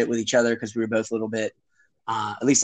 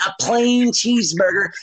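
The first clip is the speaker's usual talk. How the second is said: slow, higher, spaced-out words; drawn-out, higher-pitched recital; kids spelling out order; 6 semitones higher, slower than usual. Retold or imitated. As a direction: imitate kids ordering slowly and carefully. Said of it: "We're like".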